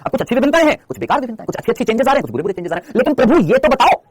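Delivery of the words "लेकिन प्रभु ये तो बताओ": slow, high and louder mock appeal to a lord; mock reverent question to Darwin; mock plea. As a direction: beg the master to explain, hammed up.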